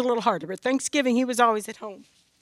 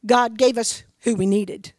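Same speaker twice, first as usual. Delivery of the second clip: usual speaking voice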